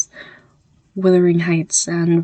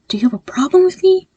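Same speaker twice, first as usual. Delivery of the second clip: higher and louder, pressing the question; angry demand; 11 semitones higher, 5 dB louder, faster than usual. Acted. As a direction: push it harder, almost shouting. Demanding an answer again.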